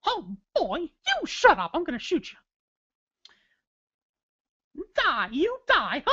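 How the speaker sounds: high, loud cartoon mouse gone mad; Mickey Mouse. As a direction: squeak out threats, then a manic high cackle. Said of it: "He'd get mad".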